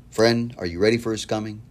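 ordinary talk, nothing marked

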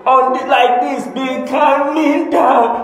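put-on high, playful child's voice demonstrating; boy showing how to calm down. A boy shows his mother how to calm down, high and playful. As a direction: gently coax mommy to calm down. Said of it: "he went again and sat and said".

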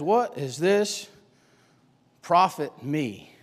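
slow, low scornful question; slow dismissive mutter. Acted. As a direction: drag each word out, brushing it off. Esau dismissing it, slowed.

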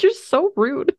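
high-pitched retold retort; mock offence.

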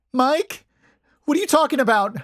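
high, loud, frightened questioning; terrified call. A narrator's reading. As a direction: call out with terror in the voice. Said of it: "I heard Addie call out".

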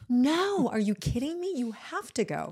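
high, loud burst of disbelief that fades; excited disbelief. Retold or imitated. Friend urging her to go to Harvard, excited disbelief. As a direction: insist she must go, half laughing.

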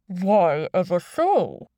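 slow, disguised villain voice; anonymous boss, drawn out; deep, distorted voice. A narrator's reading. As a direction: chide him through a voice changer. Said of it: "distorted voice".

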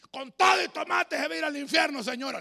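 loud hellfire street-corner yell; street preacher shouting. Retold or imitated. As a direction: shout damnation at passersby. Preacher yells at vendor.

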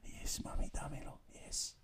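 breathy-free hushed whisper, hammed-up lover; mock seductive whisper. Host plays the lover to the caller, mock whisper.

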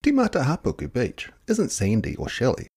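usual speaking voice

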